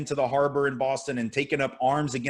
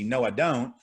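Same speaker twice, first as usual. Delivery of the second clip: ordinary talk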